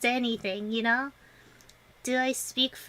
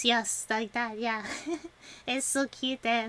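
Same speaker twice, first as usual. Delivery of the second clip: usual speaking voice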